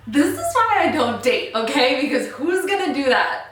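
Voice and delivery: real giddy excitement; excited gushing. Real, not acted. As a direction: gush about it, over the top. Gushing over a romantic scene.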